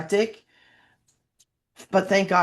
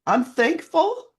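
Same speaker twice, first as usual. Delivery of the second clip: slow, higher, holding back tears; near tears; 9 semitones higher, slower than usual. Real, not acted. A single mom thanking the host, on the verge of tears. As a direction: say it choking up, real gratitude. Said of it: "I'm not gonna cry all the time".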